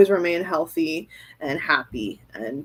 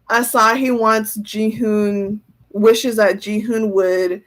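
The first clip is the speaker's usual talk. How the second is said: ordinary delivery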